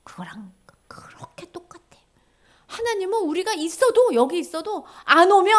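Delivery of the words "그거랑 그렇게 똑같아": hushed aside; whisper. Real, not acted. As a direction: drop to a whisper to drive it home. Sudden whisper in sermon.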